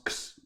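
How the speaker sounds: "x" as a bare KS sound; sound to avoid. Shown as a demonstration. Teacher isolates the ending not to pronounce, "x" as ks. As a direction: say "x" as just the consonants ks. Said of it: "do not pronounce".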